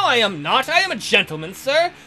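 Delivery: usual speaking voice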